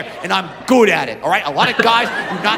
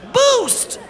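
squeaky boost. Acted. Comic voices a baby gate moment.

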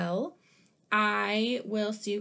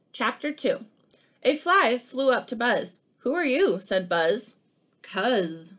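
lively picture-book read-aloud; animated storybook reading; reading to children; 4 dB louder than usual. Acted. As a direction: read it like story time for kids.

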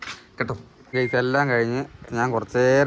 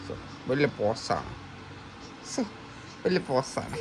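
mock scolding; 9 dB quieter, slower than usual. Real, not acted. Teasing the posing pet.

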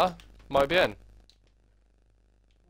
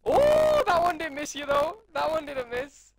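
much higher, loud squeal; excited yelp; 7 dB louder, slower than usual. Real, not acted. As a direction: shriek as the shot lands. Hit in game, excited.

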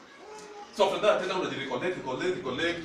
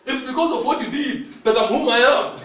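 higher, louder, laughing reply; laughing Joseph; 6 semitones higher, 6 dB louder than usual. Retold or imitated. Joseph telling his brothers they made him who he is, laughing higher and louder. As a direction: answer with a triumphant laugh.

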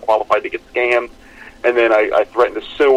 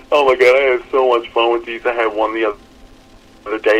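ordinary talk, nothing marked

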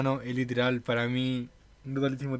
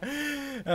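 laughing; 12 semitones higher, 5 dB quieter than usual. Real, not acted. Reacting to comedy clip.

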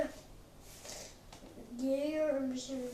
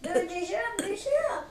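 screaming while falling; 5 semitones higher, faster than usual. Real, not acted. Character falling in game.

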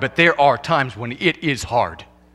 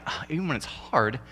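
softer, brushing it aside; dismissive newlywed scoff; 2 semitones lower, 11 dB quieter than usual. Retold or imitated. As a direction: scoff at the idea of hardship. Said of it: "you're like".

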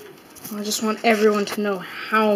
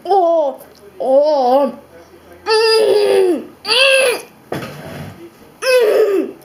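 high strained groans through burning nose; after eating wasabi; 11 semitones higher, 9 dB louder, much slower than usual. Real, not acted. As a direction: moan high and tight as the wasabi burns. Just ate wasabi-heavy sushi.

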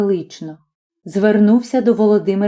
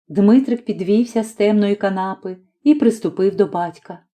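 ordinary delivery

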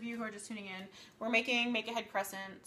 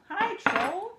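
high, loud hail to a troll; exaggerated, high-pitched hello; loud mock greeting; 10 semitones higher, 10 dB louder than usual. Real, not acted. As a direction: call out brightly to the heckler.

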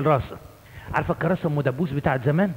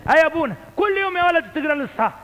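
much higher, fast mimicry of the priest; old priest voice; 10 semitones higher, faster than usual. Priest urging boy to read, imitated. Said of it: "يقول له".

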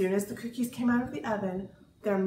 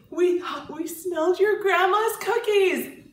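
put-on child voice; 7 semitones higher, 7 dB louder than usual. A narrator's reading. Friends voiced in story.

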